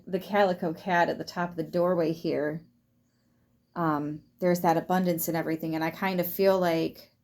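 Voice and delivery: normal talking voice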